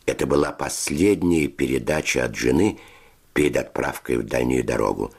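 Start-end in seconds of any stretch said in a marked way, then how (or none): none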